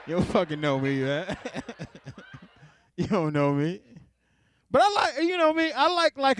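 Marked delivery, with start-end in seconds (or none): 4.7-6.4 high excited rush; worked-up and eager; pitched up with excitement. Real, not acted.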